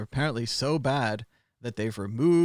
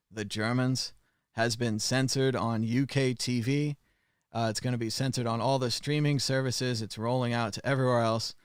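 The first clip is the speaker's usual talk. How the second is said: normal talking voice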